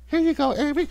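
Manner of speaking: high, soft, timid reply; meek staffer. Retold or imitated. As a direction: hand it back nervously. Meek staffer handing item back.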